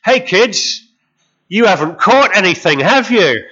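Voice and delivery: loud cheery tease from the shore; calling out. Retold or imitated. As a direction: call it as a knowing statement, not a question. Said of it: "And he's telling them".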